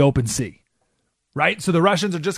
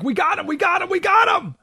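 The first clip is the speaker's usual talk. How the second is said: mock hysteria. The left finally catching Trump, high mock-hysterical cheer. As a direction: squeal it like a gleeful mob. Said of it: "The hysteria, like".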